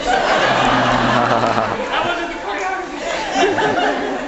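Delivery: slow words broken by laughter; laughing. Real, not acted. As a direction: laugh through it. Laughs while something goes wrong.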